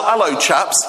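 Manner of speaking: normal talking voice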